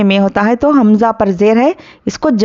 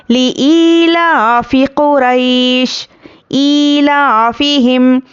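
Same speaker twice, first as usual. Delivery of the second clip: slow chanted tilawat; Quran recitation; 2 semitones higher, much slower than usual. Shown as a demonstration. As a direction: recite slowly with long vowels. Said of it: "teacher recites the verse". (धीमी तिलावत)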